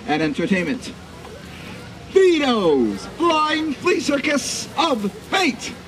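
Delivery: slow, booming ringmaster announcement; grandiose flea circus showman. Acted. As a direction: roll it out like a carnival barker. Grand opening announcement to crowd.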